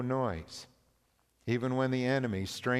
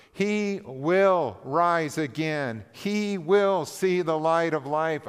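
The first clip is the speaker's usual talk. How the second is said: fervent preaching; 5 semitones higher, 5 dB louder than usual. Acted. Pastor proclaiming the servant's victory to the congregation, fervent. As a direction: preach it out, lifting the hall.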